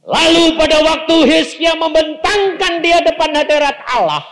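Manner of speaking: high, loud preaching; preaching at full force. Acted. Pastor proclaiming Hezekiah's prayer to the church, loud and high. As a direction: proclaim it to the back row.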